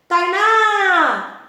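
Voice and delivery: calling "Tainá!" across the street, loud and high, stretching the ná; calling out a name. Shown as a demonstration. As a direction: shout "Tainá!" to a neighbour, leaning on the ná. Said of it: "Provavelmente eu vou fazer algo do tipo". (chamando a vizinha: "Tainá!" em voz alta e aguda)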